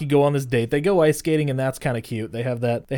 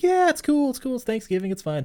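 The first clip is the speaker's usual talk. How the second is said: high-pitched, offhand reassurance; mock casual reassurance in a raised voice; lightly mimicked reassurance; 10 semitones higher than usual. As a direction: imitate someone brushing it off, starting high. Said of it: "And he's like".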